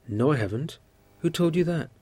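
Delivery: high, loud indignant protest. A narrator's reading. Boy denying his mother's accusation, indignant and loud. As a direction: fire back, hurt and outraged. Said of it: "Photik answered indignantly".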